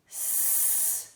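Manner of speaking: long hissed "s-", no vowel; demonstrated S sound. Shown as a demonstration. Teacher showing the sound of S, long hiss. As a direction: hold the "s-" as pure hiss. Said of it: "nós temos o S".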